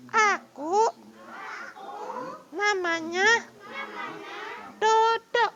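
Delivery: very high tiny voice; small voice. Shown as a demonstration. Teacher switches to the small voice in the dialogue demo, very high. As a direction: switch up to the little squeaky one. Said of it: "suara kecil yang kiri".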